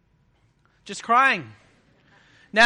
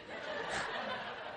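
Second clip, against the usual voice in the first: breathy laugh; 14 dB quieter than usual. Real, not acted. Laughing at his own slide.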